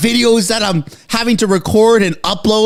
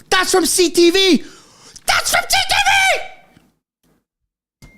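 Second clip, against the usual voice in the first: each repeat climbs higher until it breaks into a shriek; rising disbelief; 10 semitones higher than usual. Real, not acted. A streamer reading breaking news, rising to a shriek. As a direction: let the excitement run away with you.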